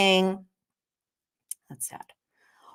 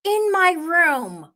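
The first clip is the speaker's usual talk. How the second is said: high accusing voice; 10 semitones higher, 3 dB louder than usual. Retold or imitated. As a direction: draw out the question in a high shocked tone. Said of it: "Meredith goes".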